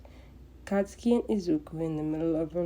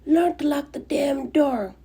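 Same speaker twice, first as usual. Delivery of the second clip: much higher and louder, a furious shout; explosive booming yell; 9 semitones higher, 7 dB louder than usual. Acted. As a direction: blast it through the door at them. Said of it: "explosive voice booms through the door".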